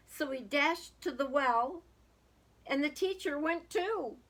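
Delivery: lively storybook reading aloud; sing-song children's story voice. A narrator's reading. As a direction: read it like a rhyming picture book to kids. Reading a picture book aloud.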